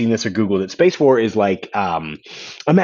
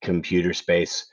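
flat, lower and slower; deadpan; 5 semitones lower, 7 dB quieter, slower than usual. Shown as a demonstration. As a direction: say the title with no excitement. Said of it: "you have to say deadpan".

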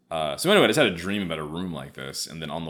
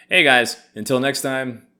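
vlog sign-off; 6 semitones higher, 10 dB louder than usual. Real, not acted. Vlog outro.

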